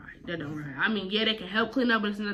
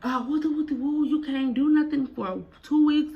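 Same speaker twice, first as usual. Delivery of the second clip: higher, slow mock mom voice; a hint of the scolding mother; mama grounding her daughter, slow and higher; imitating mama; 6 semitones higher, 3 dB louder than usual. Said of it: "my mama like".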